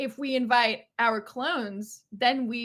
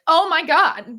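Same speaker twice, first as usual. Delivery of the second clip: surprised; 5 semitones higher, 9 dB louder, slower than usual. Retold or imitated. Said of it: "they're like".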